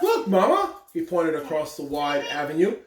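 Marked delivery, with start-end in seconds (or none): 0.0-0.8 put-on excited child.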